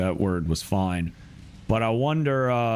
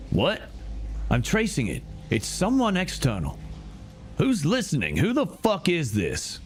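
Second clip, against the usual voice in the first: higher, tense angry demand; tense villain; 6 semitones higher than usual. Acted. Gang member demands who is listening, tense and angry. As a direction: press the intruder hard.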